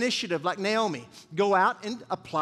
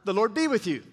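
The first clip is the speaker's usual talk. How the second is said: Boaz greeting. Shown as a demonstration. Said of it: "One more time".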